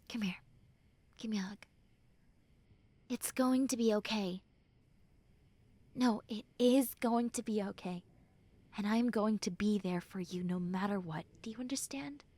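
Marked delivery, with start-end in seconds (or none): 0.1-1.7 tender whispered invitation; soft whisper. Acted. Friend whispering an offer of a hug. 11.4-12.2 hushed whispered question. Acted. Friend whispering to check the listener understands.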